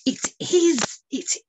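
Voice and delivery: high, thrilled cry; triumphant, voice soaring; delighted ornithologist. Acted. Ornithologist holding the captured bird, thrilled. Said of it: "said the ornithologist".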